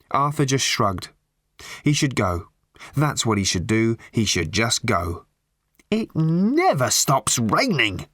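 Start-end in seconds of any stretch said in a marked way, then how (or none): 5.9-8.1 slow, high-pitched rant. A narrator's reading. Lorry driver complaining to Arthur about rain, ranting. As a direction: draw it out as an exasperated rant. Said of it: "ranted the lorry driver".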